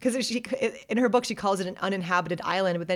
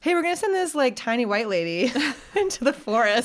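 much higher, louder, half-joking bureaucrat asking permission; mock official; 6 semitones higher, 4 dB louder than usual. Colonial official asking approval to send Jane: hammed-up, higher and louder. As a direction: ham up a colonial official floating a silly plan, chuckling mid-line.

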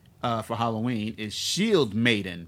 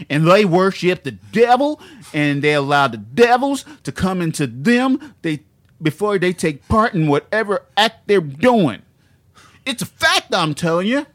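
loud, high mock Southern preacher; dumb Southern accent; 6 semitones higher, 9 dB louder than usual. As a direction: build to full hammy Southern pulpit fervor. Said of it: "here's another funny part he said".

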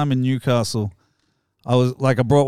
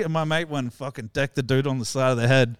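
normal talking voice

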